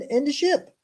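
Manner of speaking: ordinary delivery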